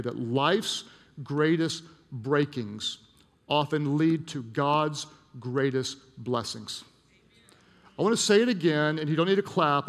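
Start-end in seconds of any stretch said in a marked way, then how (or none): 0.0-6.8 slow, weighty declaration, word by word; heartfelt declaration. Real, not acted. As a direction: state it with conviction, drawing out each phrase. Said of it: "say it and believe it and stand by it with all of my heart".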